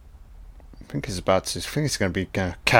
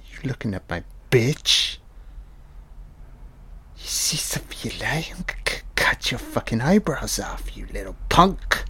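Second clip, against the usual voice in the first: softer, half-whispered villain threat; menacing Snow; 6 dB quieter than usual. Retold or imitated. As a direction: hiss the threat like a thug. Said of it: "Snow is like".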